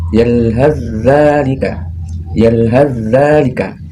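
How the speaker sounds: yalhaz zalika with h flowing into a doubled zz; drawn-out yalhaz zalika, no th sound between; slow recitation demo. Shown as a demonstration. Teacher reading the example slowly to students. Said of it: "contoh bacaannya seperti".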